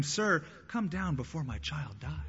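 ordinary delivery

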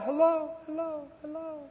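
high echo that fades to almost nothing; the mountains echoing back, fading. Retold or imitated. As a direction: let it die away like a mountain echo. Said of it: "the mountains would echo".

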